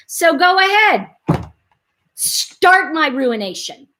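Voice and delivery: slow, drawn-out mock defiance; melodramatic dare. Child daring dad to hit her, mock melodrama. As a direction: re-enact a child's theatrical challenge, word by word.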